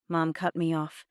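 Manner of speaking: usual speaking voice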